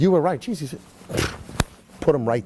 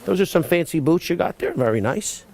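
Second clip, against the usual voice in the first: ordinary talk, nothing marked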